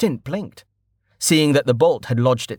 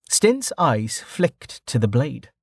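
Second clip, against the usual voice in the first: usual speaking voice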